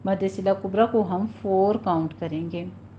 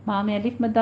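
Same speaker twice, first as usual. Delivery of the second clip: usual speaking voice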